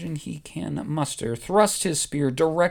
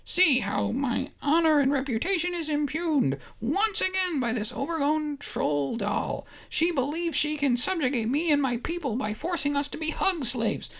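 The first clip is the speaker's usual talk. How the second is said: high, indignant Ewok protest; Ewok yelling in court; 11 semitones higher than usual. Acted. Wicket protesting to the court, high and indignant. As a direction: rail at the court with wounded pride. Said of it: "And Wicket yells in the court".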